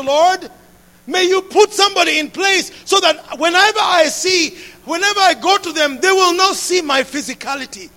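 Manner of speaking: impassioned prayer building to a loud plea; fervent prayer. Acted. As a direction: pray it out with building passion. Prays aloud in sermon.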